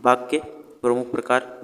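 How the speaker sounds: ordinary delivery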